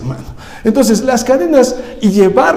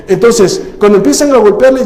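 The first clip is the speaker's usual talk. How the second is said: usual speaking voice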